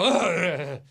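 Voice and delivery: drawn-out groan of annoyance; disgusted ugh. Shown as a demonstration. Host asking for the most annoying accent, annoyed groan. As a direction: groan as if the sound grates. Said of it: "one that just makes you go".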